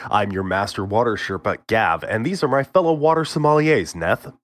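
mock-grand show host intro; podcast announcer voice. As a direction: read it like a pompous radio host.

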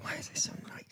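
whisper. Real, not acted. Jealous aside about D C.

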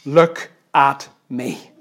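"Look at me" very slowly, word by word; slow weighty command. Acted. Preacher explaining behold to listeners, slow and deliberate. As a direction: give each word of "Look at me" its own space.

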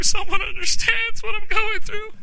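very high, thin imitation of tearful relief; mock-weeping cry of being understood; retold weeping. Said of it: "read that book and just weep".

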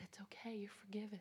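soft reassuring whisper; tender whisper. Retold or imitated. Preacher reassuring the weeping inmate in a tender whisper. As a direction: comfort her gently, barely voiced.

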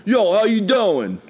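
loud, high, stretched South Jersey holler; South Jersey accent. Retold or imitated. Guest imitating an ex from South Jersey, loud accented holler. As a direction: call it out slowly in a big South Jersey accent. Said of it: "South Jersey, and every once in a while".

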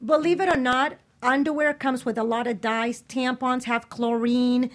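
ordinary delivery

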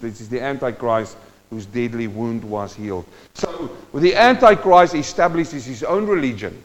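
ordinary talk

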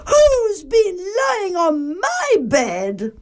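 high, slow, dismayed mother bear. A narrator's reading. Mother Bear at her bed, high-pitched dismay. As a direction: wail the question high and slowly. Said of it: "Mother Bear looked".

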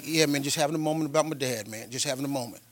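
low, soft, trailing away; subdued brush-off to a worried son; trying to stay controlled. Retold or imitated. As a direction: retell holding back tears in a hushed low voice. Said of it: "trying to be controlled".

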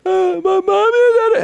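put-on very high, loud wailing kid; a tired four-year-old starting to melt down; whiny kid. Dad voices son melting down.

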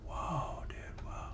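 whispered awe, voice dropped right off; retold whisper. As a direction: breathe it out in amazement. Retelling his amazed reaction.

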